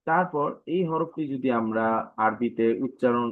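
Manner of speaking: ordinary talk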